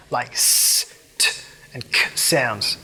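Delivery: s, t and k hissed out harshly, unvoiced; harsh hissy s sounds. Shown as a demonstration. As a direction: hiss the s, t and k sharply. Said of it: "A lot of harsh sibilance lives up in this range".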